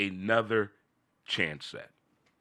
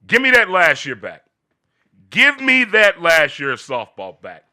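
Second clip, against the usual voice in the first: loud pleading; 6 semitones higher, 10 dB louder than usual. Real, not acted. Pleading, much louder.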